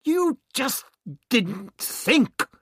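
very high, loud outburst; angry retort. A narrator's reading. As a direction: hurl it back at her angrily. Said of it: "the doctor retorted angrily".

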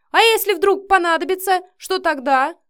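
high, loud frantic questioning; frightened outburst; panicked. A narrator's reading. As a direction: plead in rising panic. Retreating man panics at threat.

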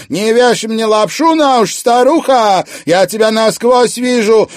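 high, loud, fast furious tirade; jealous rant. A narrator's reading. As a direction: tear into her rival. Drunk wife accuses rival.

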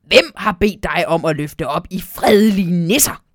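loud, cross elf scolding; indignant scolding. Acted. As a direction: tell the owl off sharply.

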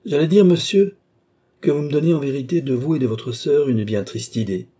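loud, high opening, easing slightly; emphatic retort. A narrator's reading. Balsamo answers menacing Philippe with a loud, emphatic retort. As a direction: hurl the answer back at him.

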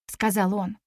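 plain reading voice

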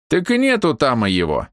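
put-on high, shrugging old man. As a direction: answer like a dismissive old man. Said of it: "пожал дед тощими плечами".

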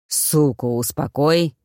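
low, slow order; deep, deliberate command. A narrator's reading. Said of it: "приказал Маффин".

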